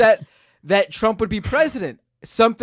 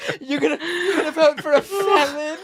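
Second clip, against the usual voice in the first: higher, laughing mock of a smarmy liberal voice; 6 semitones higher than usual. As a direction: mimic a smug, preachy liberal while giggling. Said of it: "in the most smarmy liberal way possible".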